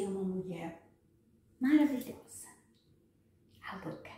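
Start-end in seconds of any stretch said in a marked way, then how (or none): 3.6-4.1 teasing whisper. Real, not acted. Speaker teasing viewers, whispering. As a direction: drop to a sly whisper.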